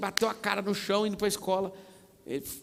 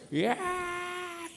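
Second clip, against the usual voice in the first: high-pitched drawn-out "aaah"; imitated child's wail. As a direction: imitate a waking kid's high cry. Imitates kids waking up noisily. (gritinho agudo de criança, imitado)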